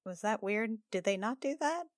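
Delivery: ordinary talk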